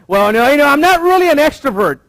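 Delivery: high, loud whiny excuse; mock reluctant churchgoer. Pastor imitating a congregant's excuse, high and loud. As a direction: play the shy member ducking out. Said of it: "have you heard that?".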